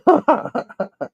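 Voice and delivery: high put-on laugh; acted high-pitched string of ha-has; mock laughing. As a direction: imitate a friend cracking up. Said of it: "Shay's just laughing".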